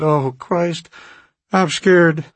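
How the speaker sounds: high, loud panicked cry; terrified. A narrator's reading. Sealed-in man cries out to Stone, high and frightened. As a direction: fear breaking through, pitched high. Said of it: "Burton was terrified".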